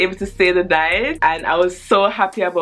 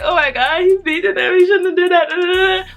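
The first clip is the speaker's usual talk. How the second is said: put-on high, louder, tearful kid voice; mock tearful protest; 13 semitones higher, 4 dB louder than usual. A child upset that her cousins get beaten, high and tearful. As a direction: plead through mock tears. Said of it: "like, crying".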